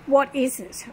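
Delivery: a touch of a nurse's demanding shout; retold nurse yelling. Nurse interrogating the patient, mild mock yell. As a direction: push the question like an impatient nurse. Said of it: "she kept yelling at me".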